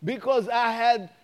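rising, insistent preacher cadence; preaching. Acted. Pastor preaching to the church and viewers, fervent. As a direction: build the crowd up with conviction. Climax of testimony.

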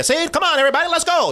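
high, fast Mid-Atlantic cartoon voice; Yakko voice. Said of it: "when he talks like that".

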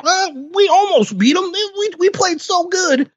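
high, whiny mock satisfaction; mocking loser fan. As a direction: parody a content fan in a sing-song. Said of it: "Be like".

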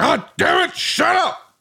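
high mock Vince bellow; Vince impression yell. Host mimics the guest's Vince-style dog yell. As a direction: ham up a Vince McMahon shout. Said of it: "you did your Vince impression to yell".

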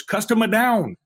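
high, loud mock casino call; dealer calling it out. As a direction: announce it to the pit boss like procedure. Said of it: "turns to his pit boss and goes".

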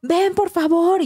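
high, slow frightened pleading; scared plea. Retold or imitated. Frightened husband begging wife to come outside, scared plea. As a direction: beg her to come, clearly spooked. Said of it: "con una voz súper espantadísima".

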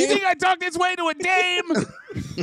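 high, hammed-up character voice; indignant question in a squeezed high voice; Pretzi. Asking if he talks this way to dames, indignant high voice. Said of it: "the Pretzi".

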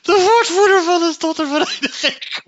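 high, loud squeal of laughter; gierend van het lachen. Real, not acted. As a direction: shriek it while cracking up. Bursts out laughing at spokesman. (hoog en luid uitgeroepen, schaterend)

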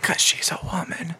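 whispered into the mic; a teasing whisper. Acted. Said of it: "Why are you whispering into the microphone?".

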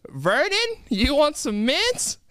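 high, drawn-out question with rising ends; grandma calling husband. Acted. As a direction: call slowly to a hard-of-hearing husband. Grandma calls to deaf husband.

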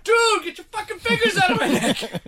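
high, laughing mock yell; retold yell at baby. Dad yelling at baby clawing his neck, high laughing mock yell. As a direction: snap it out, high and half-laughing. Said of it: "I was like".

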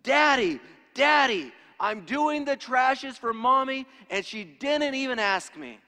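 put-on high, loud, thrilled little boy yelling; excited child calling to his dad; most excited voice. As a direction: shout it like an overjoyed four-year-old. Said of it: "in his most excited voice".

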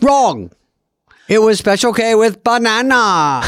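high, barking pundit verdict; McLaughlin Group impression. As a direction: bark it like a TV debate host. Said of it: "Dana doing the McLaughlin Group".

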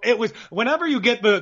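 ordinary talk

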